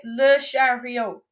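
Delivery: Le chariot said slowly and clearly to repeat; teacher sounds out Le chariot slowly; slow model word. Shown as a demonstration. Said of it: "Ok, I say it, and then you say it".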